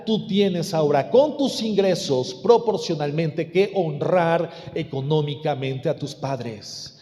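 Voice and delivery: normal talking voice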